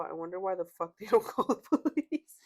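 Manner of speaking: incredulous, laughing as the words come out. Real, not acted. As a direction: read it loudly, laughing through the end. Laughing while reading a blunt comment.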